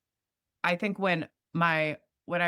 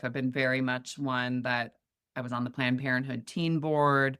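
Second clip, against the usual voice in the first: usual speaking voice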